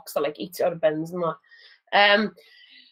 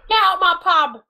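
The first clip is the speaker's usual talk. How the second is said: much higher, loud mock soap landlady yell; Peggy's pub shout; 10 semitones higher, 6 dB louder than usual. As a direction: bellow like a soap landlady throwing someone out. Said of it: "the Phil Mitchell of pigeons".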